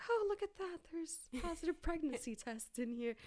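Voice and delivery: high-pitched fake discovery; feigned surprise. Pretending to find the test in her purse, mock surprise. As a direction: act innocently amazed at what you found.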